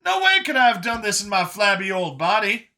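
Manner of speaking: high, animated chatter; Brian, chatty rejuvenated survivor. Acted. Brian musing on getting his youth back, high and chatty. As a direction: rattle on high and upbeat.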